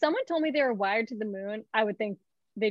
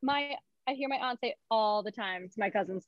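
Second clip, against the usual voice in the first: usual speaking voice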